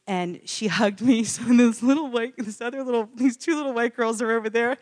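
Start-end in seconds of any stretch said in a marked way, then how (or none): none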